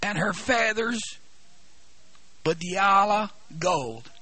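slow, loud, drawn-out preaching; solemn scripture reading. Acted. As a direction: proclaim every phrase slowly. Reads scripture in preaching cadence.

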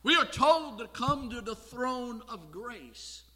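high, loud, slow proclamation; full-force preaching. Real, not acted. Preacher calling congregation to the throne of grace, full-force preaching. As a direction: proclaim it loudly, stretching the words.

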